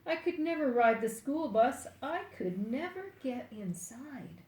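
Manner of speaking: ordinary delivery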